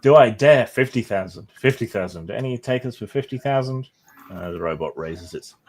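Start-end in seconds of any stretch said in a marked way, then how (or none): 0.0-3.9 louder auction call; auctioneer pushing. Acted. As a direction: egg the bidders on.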